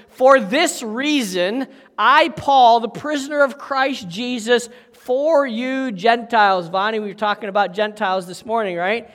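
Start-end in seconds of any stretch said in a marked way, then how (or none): 0.1-6.7 slow, weighty scripture read. Shown as a demonstration. Preacher reads verse one of Ephesians aloud, slow and solemn. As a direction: lean into each word of the verse. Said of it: "it says".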